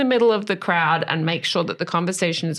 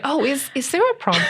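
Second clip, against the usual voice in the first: higher mock-oblivious husband; innocently oblivious; 6 semitones higher than usual. Friend imitating the author's clueless father, innocently puzzled. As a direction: imitate a husband who has only just noticed. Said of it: "didn't even say it in a passive-aggressive way".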